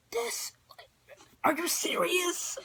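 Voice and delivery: high, hushed and slow disbelief; retold disbelief. Daughter to stepdad who needs the car, stunned disbelief. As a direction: act out stunned, drawn-out high-pitched disbelief.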